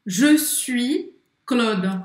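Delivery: "Je suis Claude" stretched out, rounded "je"; very slow repeat. Shown as a demonstration. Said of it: "Pode falar". (repetição bem lenta de "Je suis Claude")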